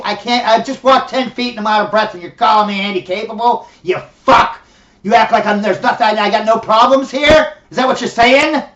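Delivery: loud, indignant rant rising in pitch; furious, incredulous shouting; angry outburst. Acted. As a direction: explode at someone who has insulted you. Acted outburst at being called handicapable.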